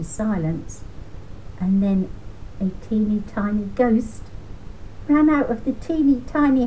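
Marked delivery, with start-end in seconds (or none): none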